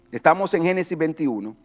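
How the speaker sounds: ordinary talk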